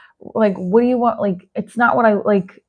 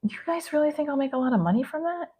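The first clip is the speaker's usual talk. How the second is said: hushed; 3 semitones higher, 13 dB quieter than usual. Real, not acted. Hushed embarrassed aside.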